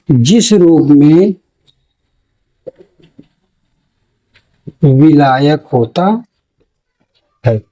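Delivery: very slow, stretched words with long pauses; slow dictation. Shown as a demonstration. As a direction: dictate phrase by phrase for note-taking. नोट में.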